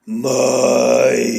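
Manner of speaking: long drawn-out eerie call; ominous summons. Acted. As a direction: stretch the name out like a monster calling. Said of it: "doesn't have a high-pitched voice anymore".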